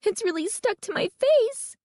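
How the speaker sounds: very high, frantic cry; shrill, terrified exclamation; panicked shriek. A narrator's reading. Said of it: "Carly Beth shrieked".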